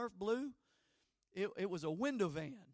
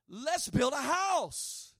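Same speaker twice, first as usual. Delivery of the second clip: mock proposal; 5 semitones higher, 8 dB louder, much slower than usual. Mock proposal to build together.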